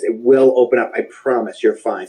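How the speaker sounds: ordinary talk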